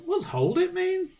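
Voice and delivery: put-on high, small puzzled kid voice; innocent child. Dad retelling his son's innocent question, high kid voice. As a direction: ask it wide-eyed like a toddler.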